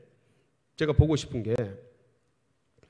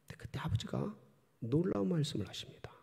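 whispered, much softer; suspenseful whisper; 3 semitones higher, 9 dB quieter than usual. Real, not acted. Preacher hushes congregation before father's words, whispering. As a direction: lean in and whisper the reveal.

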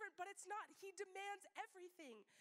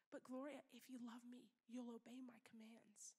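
lower, softer, sinking to a whisper; Jesus gently pressing, softer and softer; 5 semitones lower, 6 dB quieter than usual. Acted. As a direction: let it fall to a hush. Jesus voiced, fading to whisper.

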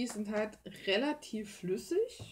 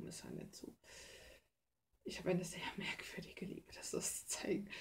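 hushed confessional whisper; 8 dB quieter than usual. Real, not acted. Admitting to viewers her strange affection, whispered. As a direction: whisper it like a guilty secret.

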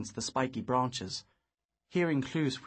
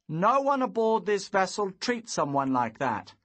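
louder stern rebuke; 7 semitones higher, 6 dB louder than usual. Acted. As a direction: lay down the law to the bully. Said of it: "I said sternly".

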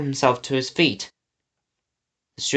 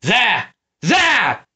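high, sharp villain's bellow; 8 semitones higher, 3 dB louder than usual. A narrator's reading. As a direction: bellow the sighting to your men. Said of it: "Snatcher bellowed".

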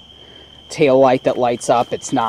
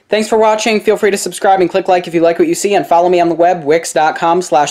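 brighter, louder sign-off pitch; upbeat outro; 6 semitones higher, 5 dB louder than usual. Acted. YouTuber signing off to viewers, bright and upbeat. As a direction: cheerfully wrap up the video for viewers.

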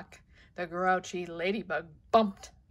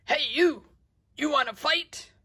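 shouting challenge; 7 semitones higher, 6 dB louder than usual. Acted. Ladybug shouts at whale tail.

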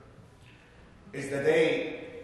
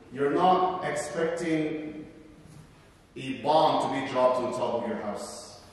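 ordinary talk, nothing marked

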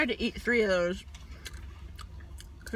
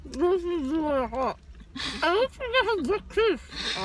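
straining to talk through marshmallows; cheeks stuffed full; 7 semitones higher, slower than usual. Real, not acted. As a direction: mouth crammed full, struggling. Struggling with stuffed mouth.

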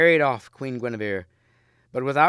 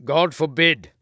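higher, louder horrified refusal; raised protest; 5 semitones higher, 8 dB louder than usual. A narrator's reading. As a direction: refuse him with force. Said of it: "said Lancelot".

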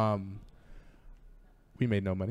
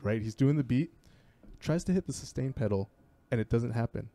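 ordinary delivery

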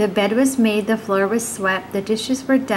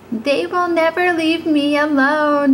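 higher, louder shout of the overwhelmed woman; storybook shout; 6 semitones higher, 3 dB louder than usual. Acted. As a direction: cry out in despair over the fairies. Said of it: "shouted the old woman".

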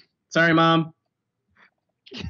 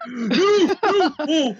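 panicked cry; 12 semitones higher, 4 dB louder, much slower than usual. Real, not acted. Scared in game.

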